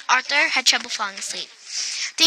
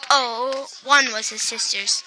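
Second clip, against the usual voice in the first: ordinary delivery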